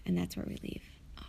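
trailing whisper. Real, not acted. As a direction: drop to a whisper. Ends summary in a whisper.

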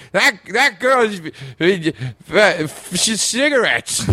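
high, halting attempt, stuck on the v of "vagina"; struggling with a word. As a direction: imitate an actor tripping over "v-, v-" and giving up. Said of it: "he, he couldn't say vagina".

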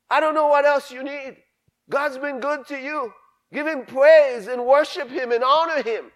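high, loud preacher's cry of thanks; preaching at full force. Real, not acted. Preacher rousing the congregation, shouting each blessing in turn. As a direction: lift the church up, calling out each blessing.

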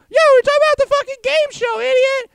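high, loud, fast mocking squeal. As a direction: squeal it out to mock the friend. Mocking friend in retold scene.

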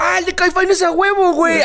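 very high silly voice; cartoonish smug rocker; frat bro. Voicing the musician's reply.

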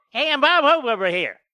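a breezy comedian mimicking; lightly voiced old-time comedian; Bob Hope impression. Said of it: "sounds like Bob Hope".

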